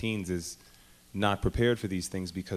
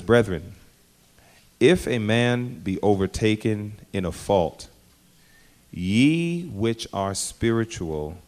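scripture reading; 5 dB louder, slower than usual. Acted. Preacher reads scripture with force.

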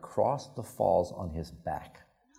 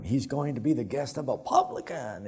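higher-pitched, put-on grumbling of onlookers; lightly mocking crowd voice; murmuring crowd; 5 semitones higher than usual. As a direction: mimic a disapproving crowd's murmur. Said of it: "the crowd was murmuring".

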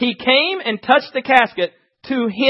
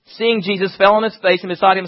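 ordinary talk, nothing marked

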